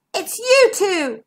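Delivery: high, drawn-out exclamation; shout. A narrator's reading. Zigbee finds his friends inside the monster, shouting. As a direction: call it out slowly in recognition. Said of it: "shouted Zigbee".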